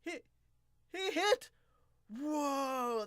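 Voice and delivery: ordinary talk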